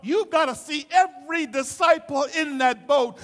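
preaching, steadily rising in force and pitch; building sermon. Acted. Preacher telling the congregation of a sinking boat, building intensity. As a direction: drive the story toward a shout.

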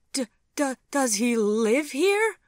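high, loud stammered gasp; shocked, stuttering high-pitched question. A narrator's reading. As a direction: stammer it out aghast, stretching the question. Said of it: "gasped Sophie".

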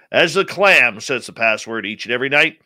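ordinary talk

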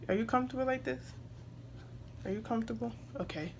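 gentle, hushed question to an infant; soft check-in. Real, not acted. Mom settling her baby, soft check-in.

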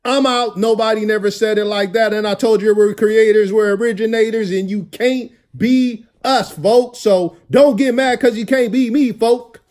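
loud, high and excited; hyped up. Real, not acted. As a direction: shout the sign-off hyped.

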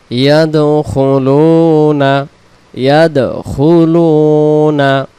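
slow, drawn-out melodic tilawat; Quran recitation. Shown as a demonstration. Teacher reciting the verse slowly for students, melodic chant. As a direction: chant each word long and steady.

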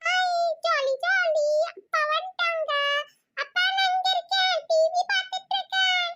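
put-on high, bouncy kid greeting dad; gleeful child. Little girl cheers as father comes home, high and gleeful. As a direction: squeal it like a happy little kid.

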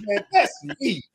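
very high squeal through laughter; mock outrage cracking into laughs; high-pitched laughing protest; laughing disbelief. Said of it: "I was excited".

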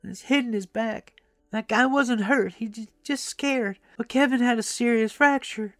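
put-on high-pitched, distressed old lady; lamenting grandmother. A narrator's reading. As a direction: pour out your grandson's misfortune, high-voiced. Old woman laments grandson's accident.

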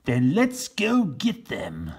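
slow, loud call to go; rally the men. Acted. Captain Bill rallying his men, slow and loud. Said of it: "said Captain Bill".

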